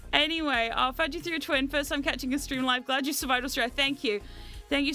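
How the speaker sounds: ordinary talk, nothing marked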